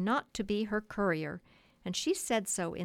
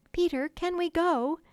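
much higher, louder begging; imploring cry; 9 semitones higher, 6 dB louder than usual. A narrator's reading. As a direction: plead eagerly all at once. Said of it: "they all cried imploringly".

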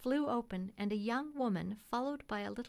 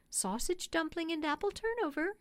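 put-on boy shouting; 8 semitones higher than usual. A narrator's reading. Young boy shouts out what his mother cooked for supper. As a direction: blurt it out like an eager young lad. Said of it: "shouted the boy".

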